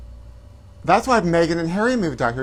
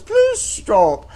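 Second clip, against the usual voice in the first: high, plummy prank-call voice; much higher put-on Camilla; Camilla Parker Bowles voice; 5 dB louder than usual. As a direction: squeak it out like a posh royal on the phone. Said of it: "phone call from Camilla Parker Bowles".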